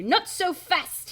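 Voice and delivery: put-on slow, high French-accented yell. A narrator's reading. Shopkeeper chasing the fleeing kids, yelling in French accent. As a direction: holler after them, drawing the words out. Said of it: "he yells".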